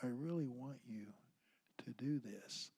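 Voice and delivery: hushed, slightly coaxing request; soft command. Shown as a demonstration. Dad getting his kids to do something, soft voice. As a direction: drop the volume and gently insist. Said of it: "get soft with my kids".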